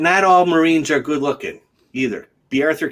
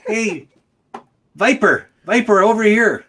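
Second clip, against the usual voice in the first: calling a dog; 8 semitones higher, 3 dB louder than usual. Real, not acted.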